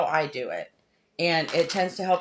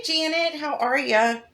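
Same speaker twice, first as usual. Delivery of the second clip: ordinary talk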